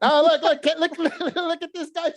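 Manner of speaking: high, laughing look look; real amused laughter. Real, not acted. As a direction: laugh through the words, pointing. Laughing at a picture.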